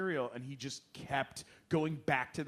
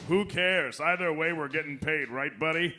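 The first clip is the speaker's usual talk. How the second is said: louder jovial cynical aside; Kelsey Grammer impression; 6 dB louder than usual. Grammer ribbing his co-star, loud and jovial. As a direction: clap a buddy on the back, loud. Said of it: "he'd go".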